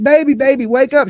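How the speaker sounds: high, coaxing put-on voice; high-pitched wife rousing her sleeping husband; mock wife waking husband. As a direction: nudge him awake in a squeaky voice. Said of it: "she's waking up her husband".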